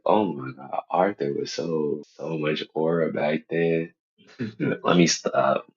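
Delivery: ordinary talk, nothing marked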